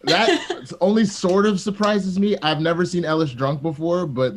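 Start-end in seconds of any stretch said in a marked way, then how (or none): 0.0-2.3 laughing. Real, not acted. Says it through a laugh.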